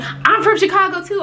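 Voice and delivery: mocking high voice. Mocking the lady who says she's from Chicago, high squeaky voice. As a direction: mimic her smug boast up high. Lady's boast in retold argument.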